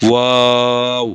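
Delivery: ওয়াও with lips rounded, stretched very slowly; rounded waw. Shown as a demonstration. Teacher showing students how to say ওয়াও, drawn out. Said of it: "ঠোঁট দুটো গোল করে উচ্চারণ হবে".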